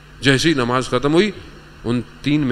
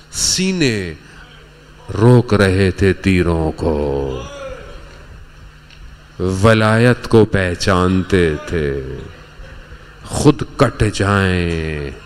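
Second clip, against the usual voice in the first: solemn extolling; 2 semitones lower, much slower than usual. Real, not acted. Preacher honouring companions who shielded the Imam, slow and solemn. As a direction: stretch each word out with weight.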